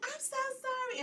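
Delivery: high, squeaky mock apology; retold apology. Donut shop girl apologizing to the host, squeaky and high. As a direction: apologize in a tiny high girly voice. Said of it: "She's like".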